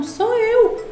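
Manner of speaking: high, insistent retelling of a protest; insistent retort. Retold or imitated. Speaker retells correcting a doubtful caller, insistent.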